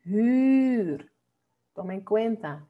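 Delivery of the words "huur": 'Huur' with a long, rounded ü; isolated 'Huur', front vowel held long; correct pronunciation. Shown as a demonstration. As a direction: model 'Huur' slowly and clearly. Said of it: "cómo se pronuncia esta palabra".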